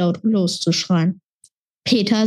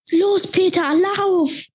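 hoher, drängender Schrei; 10 semitones higher, slower than usual. A narrator's reading. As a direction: schrei ihn an, er soll rennen. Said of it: "hörte ich Sam schreien". (Sam schreit Peter zu, er soll laufen)

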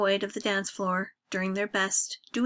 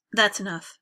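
ordinary delivery

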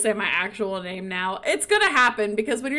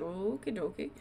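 much softer, lower reply; muted reluctant agreement; 4 semitones lower, 11 dB quieter than usual. Acted. Jasmine humoring the man about his outfit, quietly. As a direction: drop to a small, half-hearted okay.

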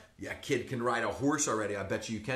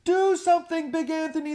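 high, loud panicked plea; townsfolk shouting; 7 dB louder than usual. Acted. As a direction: beg him at the top of your voice. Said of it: "they shouted".

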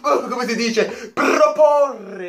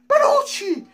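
name yelped far higher, in shock; squeaked disbelief; 13 semitones higher than usual. Real, not acted. Fan hearing Panucci named for Roma, squealing in disbelief. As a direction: squeal the name like you can't believe it.

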